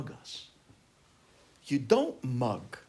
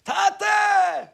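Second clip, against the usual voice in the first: high, loud call across a distance; screaming; 10 dB louder than usual. Retold or imitated. Son calling to father on the boat, high screaming. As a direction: yell out for your father. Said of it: "And I start screaming".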